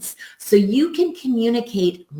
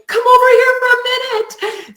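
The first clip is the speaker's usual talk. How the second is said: much higher and louder, sing-song call; high excited call; 6 dB louder than usual. Shown as a demonstration. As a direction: call them over in a bright high voice. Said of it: "using the same words but changing the tonality".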